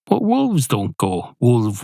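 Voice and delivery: ordinary talk, nothing marked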